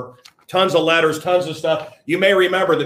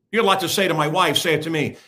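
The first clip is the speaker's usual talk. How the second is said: ordinary talk, nothing marked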